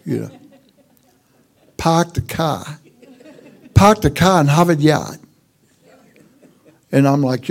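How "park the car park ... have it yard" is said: imitating a Boston accent. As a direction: mimic the Plymouth folks' Boston accent. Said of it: "they like to hear how I talk".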